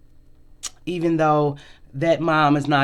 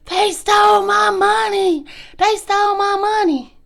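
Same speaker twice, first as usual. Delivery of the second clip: put-on frantic drunk accusation; 5 dB louder than usual. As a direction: shriek it like a drunk friend crying robbery. Drunk friend accusing police scene.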